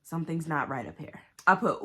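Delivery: normal talking voice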